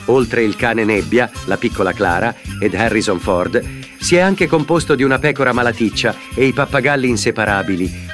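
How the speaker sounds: ordinary talk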